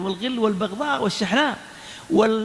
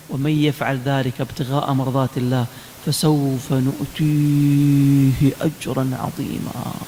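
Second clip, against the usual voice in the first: low recitation slowing into long held vowels; Quran recitation; 8 semitones lower, 3 dB louder, much slower than usual. Real, not acted. Preacher promising reward from the Quran, in drawn-out recitation. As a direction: chant the verse, stretching the ending.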